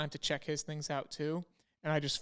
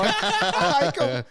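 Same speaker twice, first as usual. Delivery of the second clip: laughing; 6 semitones higher, 8 dB louder, slower than usual. Real, not acted. Laughs while telling.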